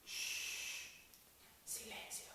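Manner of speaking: very soft whispered hush; barely audible plea for silence; whispered shushing. Shown as a demonstration. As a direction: gently shush someone in a whisper. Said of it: "No es lo mismo".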